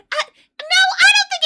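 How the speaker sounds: high, loud, tearful whimper; mock crying wail. Imitating Wonder Woman crying over a mean comment. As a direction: wail it like a hurt diva. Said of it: "And she goes".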